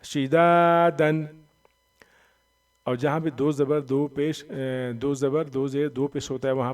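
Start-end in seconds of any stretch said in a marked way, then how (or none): 0.0-1.3 "शिदादन" with loud, drawn-out दा; long madd. Shown as a demonstration. Teacher modelling the word for learners, long दा in "शिदादन". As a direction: hold the दा of "शिदादन" steadily. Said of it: "अभी पढ़ें आप इसको".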